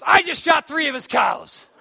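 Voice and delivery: high, loud excited shout; proud shout. Retold or imitated. Buddy boasting to his friend after the shooting, loud and high. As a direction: announce it proudly with gusto.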